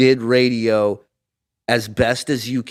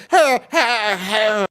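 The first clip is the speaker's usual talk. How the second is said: high, raspy hey hey hey cartoon yelp; Tan Mom impression mixed with Underdog; 9 semitones higher, much slower than usual. Comedian showing his Tan Mom bit to the radio host, high cartoon yelp. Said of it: "a mix of Underdog and Tan Mom".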